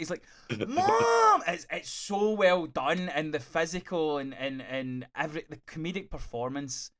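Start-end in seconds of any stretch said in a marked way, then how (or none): 0.7-1.4 very high, loud mock whine; thirteen-year-old girl tantrum. Grown son performed as thirteen-year-old girl, high mock yell. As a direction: shriek it like an exasperated young teen. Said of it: "like, a fucking thirteen-year-old girl".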